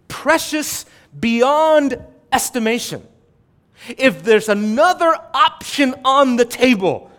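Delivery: fervent preaching. Acted. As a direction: preach it out to the congregation. Preaching at full force.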